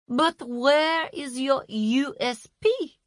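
slow, condescending manager; brisk boss voice. A narrator's reading. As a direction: talk down like a boss. Masha, brisk corporate voice.

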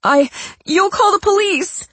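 high, frightened accusation; scared. Pretend. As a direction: recoil in fear. Patricia's faked fear.

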